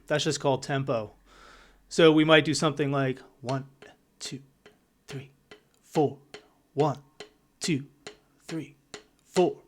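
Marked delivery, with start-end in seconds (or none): 3.4-9.6 one, two, three, four counted slow with long gaps; slow, spaced count-in; slow ballad count. Shown as a demonstration. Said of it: "that's for our ballads".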